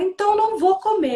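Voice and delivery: high, loud mock huff; defiant child. Mother mimics her son refusing to eat, high defiant protest. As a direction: imitate a little boy digging in. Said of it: "E ele falou".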